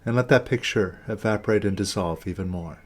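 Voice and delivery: measured meditation guidance with long silences and falling endings; guided meditation. Real, not acted. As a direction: lead them inward, one short instruction at a time.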